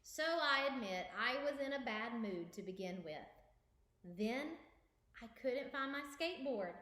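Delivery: lively storybook narration; reading to children. Acted. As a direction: read it aloud for kids. Reads picture book aloud.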